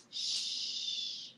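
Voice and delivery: soft, long "shh"; shushing. Real, not acted. Owner quieting her barking dog, soft shush. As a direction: hush the dog softly.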